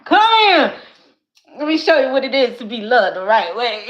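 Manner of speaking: loud, high, laughing call of her future man; imagined man calling her. Retold or imitated. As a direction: boom out his welcome through laughter. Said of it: "He gonna be like".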